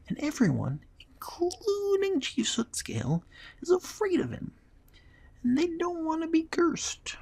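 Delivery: very high squeaky kobold voice; squeaky little kobold spilling a secret; reluctant kobold confession. Acted. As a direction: give in and explain as a small creature, high-pitched.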